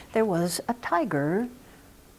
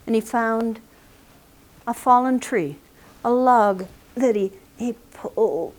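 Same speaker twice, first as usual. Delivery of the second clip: usual speaking voice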